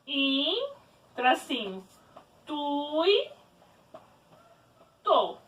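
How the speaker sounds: "In", "tui", "to" spoken as separate syllables with pauses. Shown as a demonstration. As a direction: split the word into syllables, softly. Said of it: "eu vou separar essa palavra em sílaba". (separando "In", "tui", "to" em sílabas, devagar)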